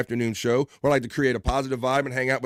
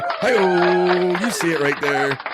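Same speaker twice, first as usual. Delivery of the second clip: loud, higher hyped whoop; louder, higher cheer about the shirt; excited exclamation; 6 semitones higher, 6 dB louder, much slower than usual. Real, not acted. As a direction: show it off with a whoop. Showing off viewer's art.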